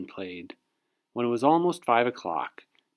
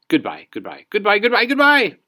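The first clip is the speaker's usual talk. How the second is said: goodbyes climbing higher and louder; panicked goodbyes; 11 semitones higher, 8 dB louder than usual. Acted. Frightened girl fleeing the giant's house, rising panicked goodbyes.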